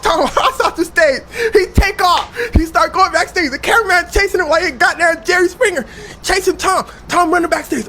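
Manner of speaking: high, loud breakneck storytelling; frantic chase telling. Real, not acted. Comic retelling Tom's run backstage, frantic excited shouting. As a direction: shout it like a live chase report.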